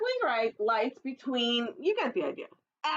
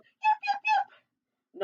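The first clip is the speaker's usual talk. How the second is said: very high little fox yips; high squeaky fox call; 4 dB louder, much slower than usual. Acted. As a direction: yap it out like a small fox calling. Fox calls out in story.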